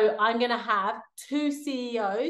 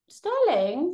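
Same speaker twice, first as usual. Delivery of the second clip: calling the cat; 9 semitones higher, 4 dB louder than usual. Real, not acted.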